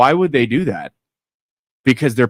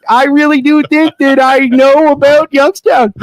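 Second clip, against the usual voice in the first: put-on high, loud Canadian accent; high Canadian pitch to the locals; 11 semitones higher, 4 dB louder than usual.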